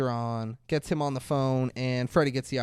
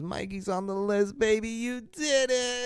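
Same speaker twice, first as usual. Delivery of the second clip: high-pitched happy crying, acted; tearful joy; 7 semitones higher than usual. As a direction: imitate an overjoyed wife, voice climbing high. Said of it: "they're both crying and they're so happy".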